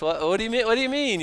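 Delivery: high-pitched, incredulous protest; mock indignation. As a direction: act out disbelief, pitch climbing. Acting out receivers of a meager offering.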